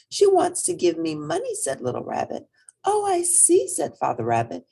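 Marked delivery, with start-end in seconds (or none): 0.1-1.5 put-on high childlike voice; little rabbit. A narrator's reading. 2.8-3.7 high, amused "oh I see"; a father playing along; father rabbit. A narrator's reading.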